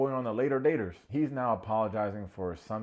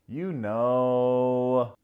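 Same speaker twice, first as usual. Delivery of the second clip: coy; 3 semitones higher, 10 dB louder than usual. Retold or imitated. Coy dodge of the question.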